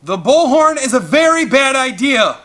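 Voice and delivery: loud, high urgent yell. A narrator's reading. Parents yelling at the man with the bullhorn, frantic. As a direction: shout the warning across the beach. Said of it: "waving their arms and yelling".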